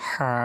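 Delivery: throaty pressed হা. Shown as a demonstration. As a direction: squeeze হা from mid-throat. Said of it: "চেপে বলবো আমরা হা".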